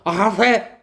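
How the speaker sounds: brash mock Peter; cocky Peter. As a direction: blurt it out overconfident. Peter boasts he can agape.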